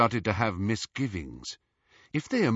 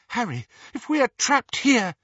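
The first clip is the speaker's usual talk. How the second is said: frightened; 12 semitones higher, 4 dB louder than usual. A narrator's reading. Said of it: "horror-struck face".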